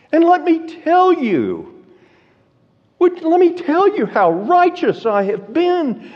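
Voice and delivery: high, loud, desperate pleading voice; anguished crying out. Retold or imitated. Job crying out to God in frustration and anxiety, high anguished pleading. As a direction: plead as if begging God to finally hear you. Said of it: "he's crying out in his frustration and his anxiety".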